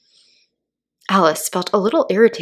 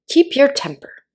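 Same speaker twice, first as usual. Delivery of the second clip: higher, louder caterpillar warning; a lofty, lightly voiced caterpillar scold; 8 semitones higher, 6 dB louder than usual. A narrator's reading. Caterpillar telling Alice to calm down, lofty. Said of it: "said the caterpillar".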